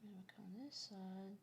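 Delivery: quick soft whisper. Real, not acted. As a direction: murmur it under your breath. Whispering while drawing.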